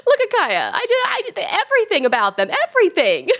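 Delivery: high, loud, giddy fangirling; gushing. Real, not acted. Streamer fangirling over Kaeya, high and loud. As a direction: swoon over a favourite character.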